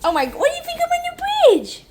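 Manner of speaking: very high, squeaky pet voice; dog voice. Pretend. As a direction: talk like a pampered puppy. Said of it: "I'm talking in my dog voice".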